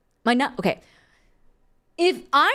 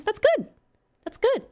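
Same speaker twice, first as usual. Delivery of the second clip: coach voice; 10 semitones higher than usual. Pretend. Mock coach praise.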